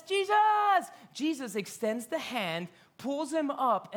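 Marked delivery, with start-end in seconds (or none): 0.0-0.9 high, loud cry for help; desperate cry. Retold or imitated. Sinking Peter crying out to Jesus, desperate. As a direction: scream his name as you go under.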